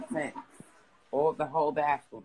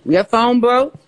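calling out; 8 semitones higher, 13 dB louder, slower than usual. Real, not acted. Calling to someone wearing headphones.

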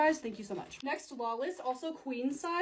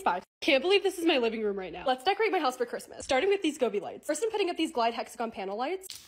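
ordinary talk

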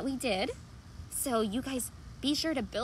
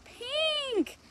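bright reveal; 13 semitones higher than usual. Acted. Revealing favourite color.

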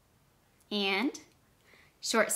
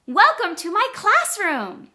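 much higher and louder, bursting with excitement; really excited; 10 semitones higher, 10 dB louder than usual. Shown as a demonstration. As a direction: announce it with big excitement. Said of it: "if I say really excited".

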